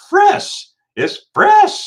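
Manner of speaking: very high, loud squeal; squeaky, over-the-top delight; ecstatic raving. Retold or imitated. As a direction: imitate yourself raving while knocked out. Imitates own raving under anesthesia.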